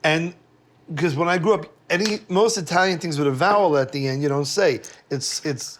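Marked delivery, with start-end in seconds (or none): none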